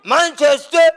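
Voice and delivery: shouted name, rising at the end; angry shout. Acted. Angry elder shouting at the young man. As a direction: yell it to force an answer.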